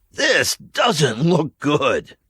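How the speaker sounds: put-on teen boy staring at a wrecked cafeteria, slow; Brad, uneasy. A narrator's reading. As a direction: say it slowly, dreading.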